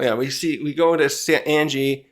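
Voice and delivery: ordinary talk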